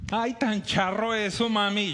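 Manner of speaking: lightly voiced Bogotá girl accent; daughter's accent. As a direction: mimic the girl's Bogotá lilt. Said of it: "Escúchela hablar en español".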